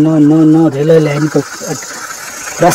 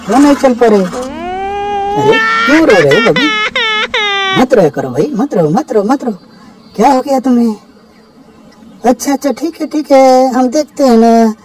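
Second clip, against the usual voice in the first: much higher, tender cooing to a crying doll; baby-talk comfort, pitched way up, rising to a squeal on the crying line; soothing a crying baby; 8 semitones higher than usual. Pretend. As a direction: coax the baby doll to stop crying, sweet and high.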